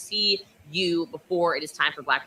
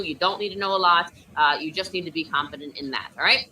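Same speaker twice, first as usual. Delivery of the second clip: normal talking voice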